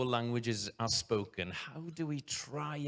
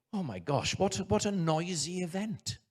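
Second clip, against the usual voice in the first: mock surprise; 8 semitones higher than usual. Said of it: "you might think".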